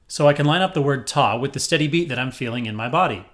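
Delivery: normal talking voice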